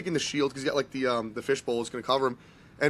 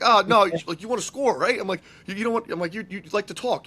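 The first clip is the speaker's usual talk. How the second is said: higher, eager persuading voice; coaxing dad; 6 semitones higher, 5 dB louder than usual. Retold or imitated. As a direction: sell it to your kid brightly. Coaxing son away from goalie.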